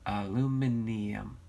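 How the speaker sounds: "aluminium" slowly, with an extra "ee" before the final "um"; British pronunciation. Shown as a demonstration. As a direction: sound out "aluminium" as al-yoo-MIN-ee-um, slowly. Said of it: "What'd Sally say?".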